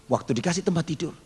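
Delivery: ordinary delivery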